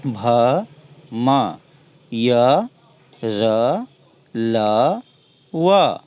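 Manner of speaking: each consonant sounded alone with pauses; teacher reading the consonant chart aloud; reciting consonants. Shown as a demonstration. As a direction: call each letter out clearly for the class. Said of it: "फिर से मैं पढ़ता हूँ".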